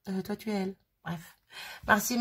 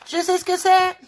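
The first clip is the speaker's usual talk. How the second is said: excited; 12 semitones higher, 10 dB louder than usual. Real, not acted. Sudden guess while feeling package.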